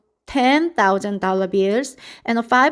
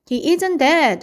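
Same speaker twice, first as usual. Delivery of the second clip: hollered; 6 semitones higher than usual. A narrator's reading. Zuckerman hollers at the loudspeaker, raised and urgent. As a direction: call out that he is not dead. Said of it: "hollered Zuckerman".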